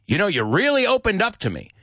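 high-pitched, quick sarcastic jab; acted sarcastic praise. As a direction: pretend to be grateful, meaning the opposite. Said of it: "He was being sarcastic".

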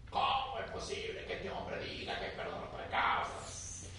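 hushed whisper of grumbling scribes; whispered aside. Retold or imitated. Scribes muttering among themselves, whispered. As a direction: whisper it as a secret thought. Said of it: "eso no lo dicen en voz, en voz alta".

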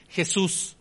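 ordinary talk, nothing marked

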